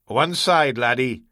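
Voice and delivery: usual speaking voice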